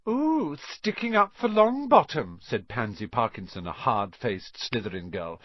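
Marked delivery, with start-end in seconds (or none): 0.1-2.4 high, teasing jeer; mocking schoolgirl taunt. A narrator's reading. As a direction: needle a classmate in a raised pitch. Girl taunts a classmate.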